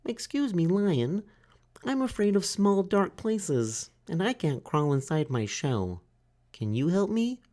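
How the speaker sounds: high, timid little turtle voice; small turtle Franklin. A narrator's reading. Franklin asks the duck for help, high and timid. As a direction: ask like a shy child.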